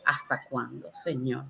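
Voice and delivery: low, soft, weary reproach; a hushed, drained complaint; quiet plea. Retold or imitated. Mother praying in hospital for her dying baby, soft and low. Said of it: "Mi oración fue de reclamo".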